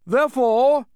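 high, loud, declaimed opening; grand proclamation. A narrator's reading. Duke concludes the proclamation to the crowd, grand declaiming. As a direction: ring it out like a herald. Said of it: "concluded the Duke".

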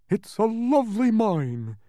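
high, slow, loud apology in a put-on voice; apologetic. A narrator's reading. Intimidated humbug placating the mine's owner, high apology. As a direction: apologize in a high, drawn-out character voice. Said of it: "apologized the humbug".

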